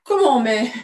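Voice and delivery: mild, thrown-off stammer; taken-aback stammer. Acted. Ex-husband refused the robot, taken-aback stammer. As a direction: falter as if caught off guard. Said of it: "balbutia-t-il désarçonné".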